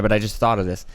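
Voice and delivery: ordinary delivery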